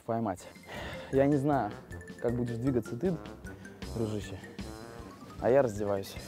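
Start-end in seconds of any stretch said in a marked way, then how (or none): none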